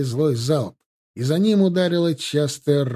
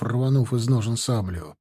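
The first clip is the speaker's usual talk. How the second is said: usual speaking voice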